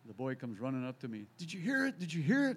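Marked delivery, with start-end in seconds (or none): 1.4-2.6 high, louder eager questions; a kid rushing up, thrilled; excited boy. Retold or imitated. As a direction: burst in breathless with news.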